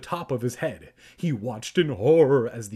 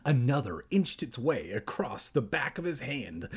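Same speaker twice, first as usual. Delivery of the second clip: ordinary delivery, nothing marked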